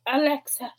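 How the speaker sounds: mildly exasperated device command; annoyed. Shown as a demonstration. Showing how they address their own Alexa, mildly annoyed. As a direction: call Alexa with a touch of irritation. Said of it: "I get really annoyed at mine".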